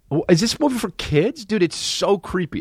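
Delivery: ordinary delivery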